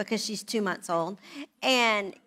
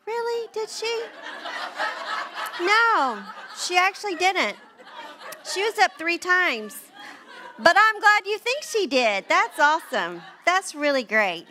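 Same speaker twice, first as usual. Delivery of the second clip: high, loud mock disbelief; sarcastic; 8 semitones higher, 4 dB louder than usual. Wife sarcastically answering husband, high and loud. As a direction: needle him with sarcasm, laughing. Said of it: "I'm like".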